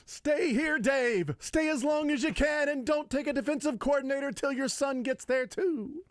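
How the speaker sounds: high, belted comic plea; over-the-top high comic bellow; Adam Sandler. Acted. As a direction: wail it out in a goofy high voice. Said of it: "this is a great Adam Sandler impression".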